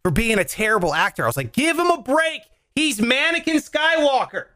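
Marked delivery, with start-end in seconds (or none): 1.5-4.4 high-pitched mock protest; higher-pitched indignant plea; exasperated defence. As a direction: plead his case, exasperated. Own exasperated quote defending actor.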